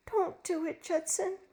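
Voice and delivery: high pleading appeal; begging. A narrator's reading. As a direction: beg him to stop. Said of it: "she begged".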